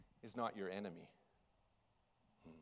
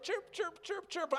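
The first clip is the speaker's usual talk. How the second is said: very high chirped chirp, chirp; high bird chirps. Acted. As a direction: chirp it like an excited little bird. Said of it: "he began to chirp".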